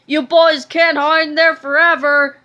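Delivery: loud robot taunt; shouting. A narrator's reading. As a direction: bellow it at the locked door.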